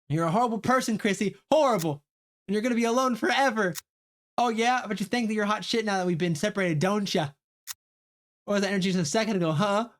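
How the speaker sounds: high, loud furious taunting; catty screaming match; angry shouting girl. Acted. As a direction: hurl insults at her rival. Girl screaming insults at rival.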